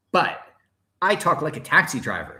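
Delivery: normal talking voice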